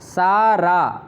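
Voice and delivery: सा रा said slowly, syllable by syllable; slow split of सा रा; syllables split apart. Shown as a demonstration. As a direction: pull सा and रा apart clearly. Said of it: "कि सा और रा मिले हैं".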